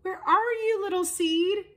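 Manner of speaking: high, searching call. A narrator's reading. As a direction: call out high for a lost friend. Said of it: "cried Little Fox".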